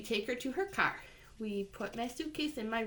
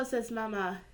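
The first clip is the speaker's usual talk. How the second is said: ordinary delivery, nothing marked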